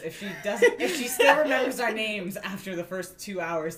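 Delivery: laughing while talking; words broken by laughter. Real, not acted.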